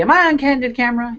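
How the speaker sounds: a hint of Bill Cosby asking fast; Bill Cosby impression. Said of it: "Feel like Bill Cosby".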